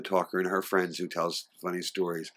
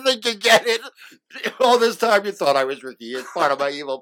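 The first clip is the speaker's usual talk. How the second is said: mock villain; 11 semitones higher, 7 dB louder than usual. Mock villain reveal, laughing.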